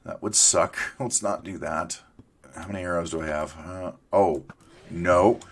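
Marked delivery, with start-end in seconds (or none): none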